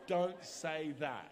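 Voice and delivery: slow, weighty warning; slow firm warning. Real, not acted. Preacher warning young men, slow and firm. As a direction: drag each word out as a caution.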